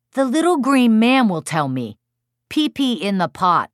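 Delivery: put-on high, loud, eager small child; high-pitched young son voice; little boy. A narrator's reading. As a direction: chirp it like a pleased preschooler. Narrator voices small child's idea.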